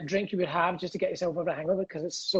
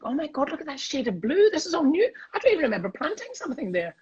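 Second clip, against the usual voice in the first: mock delight; 7 semitones higher than usual. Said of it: "I was like".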